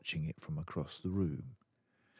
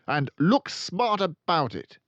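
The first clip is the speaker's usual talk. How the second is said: much louder, higher command; shouted; 12 semitones higher, 9 dB louder than usual. Acted. Headmistress ordering the boy up, with a loud shout. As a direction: shout it across the hall. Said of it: "the Trunchbull shouted".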